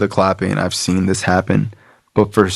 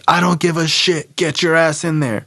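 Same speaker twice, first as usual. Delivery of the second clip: high-pitched, put-on scolding; bossy woman; 9 semitones higher than usual. Woman ordering newcomer into the meeting, bossy. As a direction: imitate a woman ordering him about. Voices Charity ordering him in.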